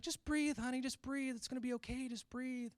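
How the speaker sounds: wife coaching her husband through pain, high and soothing. Retold or imitated. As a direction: coax him to breathe like a worried wife. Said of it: "She's like".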